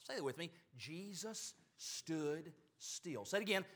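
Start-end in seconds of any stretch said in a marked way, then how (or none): 0.8-3.2 soft, drawn out word by word; slow, deliberate recital. Shown as a demonstration. Preacher having the congregation say it with him, very slow. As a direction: lead them slowly, spacing "Jesus", "stood", "still". Leads congregation in repeating phrase.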